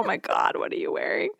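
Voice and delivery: whispered, teasing disbelief; whispered mock shock. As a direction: breathe it out like a stunned mom. Mom teasing daughter's outfit.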